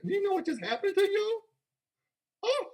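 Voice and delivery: high, hyped-up question; excited. Real, not acted. Hyping the audience about coin gains, high excited squeal. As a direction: let the voice leap up with excitement.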